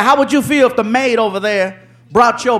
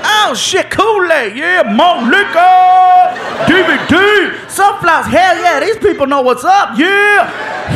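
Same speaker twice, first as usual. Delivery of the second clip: thrilled high voice; 6 semitones higher, slower than usual. Acted. Neighbor unpacking the basket item by item, gleefully. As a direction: savor each item like a treasure.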